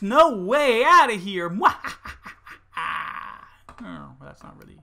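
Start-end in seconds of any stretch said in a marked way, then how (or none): 0.0-1.6 loud slow wail; loud frustrated cry. Real, not acted. Loud trapped outburst. 1.6-3.7 laugh. Real, not acted. Laugh after outburst.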